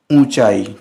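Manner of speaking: "ऊंचाई" with nasal oo, no n; correct pronunciation. Shown as a demonstration. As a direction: say "ऊंचाई" as oo-chai, nasal vowel. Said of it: "शुद्ध क्या हुआ?".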